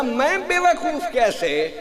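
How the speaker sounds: amused retort. Retold or imitated. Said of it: "امام صاحب نے فرمایا".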